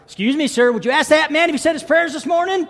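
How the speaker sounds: put-on high, shouting little boy. Boy has Stan ask the next man, shouting insistently. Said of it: "start getting louder and louder".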